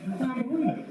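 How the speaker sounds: high-pitched incredulous cry; retelling his disbelief at his brother's cut finger, high incredulous; stunned disbelief. Retold or imitated. Reacting to the cut-off finger, high incredulous cry.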